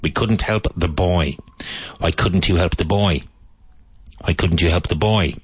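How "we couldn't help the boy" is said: put-on "boy" with a firm, strong B; "boy" said with a clear voiced B. Shown as a demonstration. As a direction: punch the B in "boy". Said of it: "Strong B with boy".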